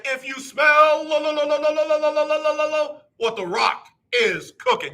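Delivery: high shouted stretched lo lo lo, then a drop; The Rock impression. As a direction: belt out the catchphrase like a wrestling promo, drawing out each lo. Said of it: "me doing The Rock".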